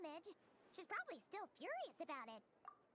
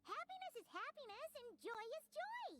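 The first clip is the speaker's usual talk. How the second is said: cheerful; 7 semitones higher than usual. Acted. Paimon cheering encouragement.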